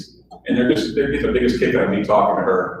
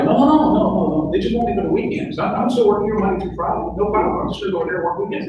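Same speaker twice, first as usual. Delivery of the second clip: protesting; 9 semitones higher, faster than usual. Retold or imitated. Pleading with boss.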